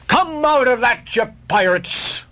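high, slow bellow at the pirates; captain roaring. Acted. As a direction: roar it out, drawn long and high. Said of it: "roared the captain".

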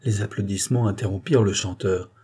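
ordinary delivery, nothing marked

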